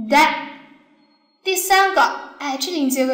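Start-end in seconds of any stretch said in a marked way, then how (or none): 0.1-0.3 da said short and quick; short open syllable. Shown as a demonstration. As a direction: keep the vowel in da short. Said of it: "短音阶开口".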